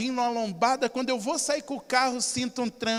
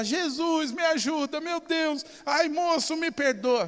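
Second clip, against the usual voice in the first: put-on lightly raised, pleading old lady's voice; a hint of a frightened old woman begging; distraught old lady; 6 semitones higher, slower than usual. Old lady apologizing to the driver, lightly raised and pleading.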